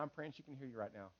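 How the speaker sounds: low, very soft bedside reassurance; comforting pastor. Retold or imitated. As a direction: gently console a grieving family. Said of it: "you just kinda comfort them".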